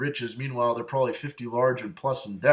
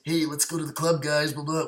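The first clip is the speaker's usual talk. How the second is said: higher-pitched imitation of a club bro; mock club-goer voice, raised a little; mocking club-guy voice; 3 semitones higher than usual. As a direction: mimic the snobby club crowd, pitched up. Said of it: "talking like this".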